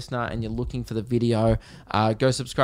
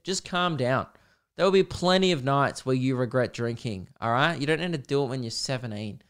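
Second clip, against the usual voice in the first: ordinary talk, nothing marked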